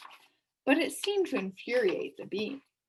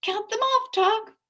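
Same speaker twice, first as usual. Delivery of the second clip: put-on high, loud boy's call; excited young boy; eager urging; 13 semitones higher, 8 dB louder than usual. A narrator's reading. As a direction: urge the count on. Milo urging the count.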